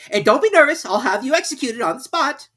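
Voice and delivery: very high, loud royal command; a pompous king giving orders; King ordering the herald to read; King's voice. A narrator's reading. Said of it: "said the king".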